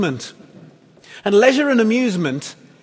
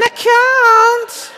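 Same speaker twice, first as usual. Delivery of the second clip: very high, loud puppet wail; Orville; 6 dB louder than usual. Acted. Puppet bird rejecting encouragement, high wailing northern voice. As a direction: squeal the refusal, long and drawn out. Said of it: "Orville syndrome".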